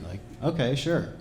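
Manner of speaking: ordinary delivery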